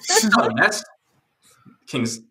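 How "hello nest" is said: mock greeting. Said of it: "the official greeting".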